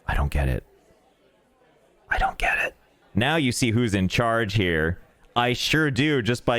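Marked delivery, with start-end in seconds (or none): 2.1-2.7 breathy unvoiced whisper; whispered repeat of the line. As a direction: whisper it like a secret. Repeats line, second time whispered.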